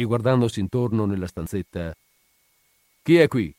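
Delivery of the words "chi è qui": raised, higher-pitched demand; loud question. A narrator's reading. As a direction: call it out to whoever is in the room. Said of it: "chiese a voce alta". (domanda a voce alta)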